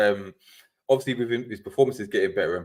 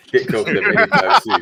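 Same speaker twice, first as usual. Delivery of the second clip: laughing; 10 semitones higher, 7 dB louder than usual. Real, not acted. Laughing at own club's chances.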